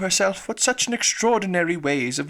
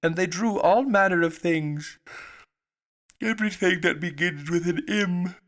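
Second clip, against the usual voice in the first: sleepy; 4 semitones higher than usual. Acted. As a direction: talk as if nodding off. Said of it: "yawning and rubbing its eyes".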